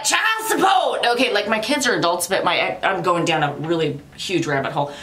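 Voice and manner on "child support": higher, slow playful yell of a demand; mock yell. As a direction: call it out like a church chant. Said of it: "so I was like".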